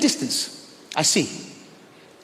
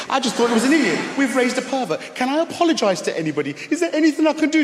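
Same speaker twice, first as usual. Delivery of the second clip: panicked inner voice; 8 semitones higher than usual. Retold or imitated. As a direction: scramble to make amends.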